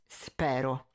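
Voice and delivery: usual speaking voice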